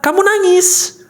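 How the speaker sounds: high-pitched inner-voice retort; subconscious answering back. Acted.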